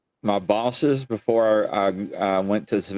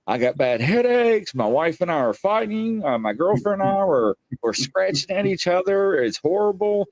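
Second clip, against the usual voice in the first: frantic caller; 7 semitones higher than usual. Retold or imitated. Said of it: "they would call me up like".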